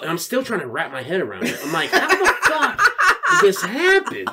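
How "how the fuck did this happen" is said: high, loud, laughing incredulity; astonished disbelief. Retold or imitated. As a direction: exclaim it in comic amazement. Retold astonishment, laughing as told.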